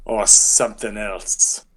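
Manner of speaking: very slow, put-on guard's query; doubting guard voice. Guard queries the surname he was given, slow character voice. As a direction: draw the question out in character. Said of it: "He says".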